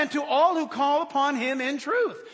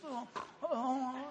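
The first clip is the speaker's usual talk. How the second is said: softer, drawn-out waking moan; groggy groan; 9 dB quieter than usual. Acted. Preacher imitating normally waking up, groggy groan. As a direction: groan as if dragged from sleep.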